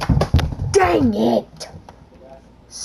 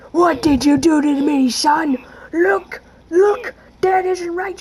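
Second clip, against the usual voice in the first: higher, loud indignant complaint; outraged character; 6 semitones higher, 5 dB louder than usual. Acted. Injured character yelling at his son. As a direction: demand an answer, outraged.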